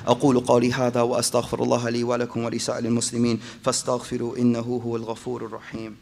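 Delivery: fast formulaic Arabic recitation; rapid khutbah formula. Acted. Preacher reciting the khutbah formula, fast and steady. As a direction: rattle off the opening formula.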